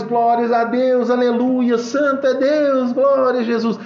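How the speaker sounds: high, slowed exclamations of praise; children giving glory. Retold or imitated. Children jumping with joy, giving glory. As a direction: imitate rejoicing kids praising. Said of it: "Os meninos dando glória".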